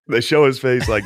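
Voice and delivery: normal talking voice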